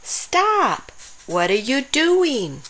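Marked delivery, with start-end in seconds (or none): 0.0-0.9 high sudden shout of stop; sharp stop. Acted. Dinosaur stops another from scratching.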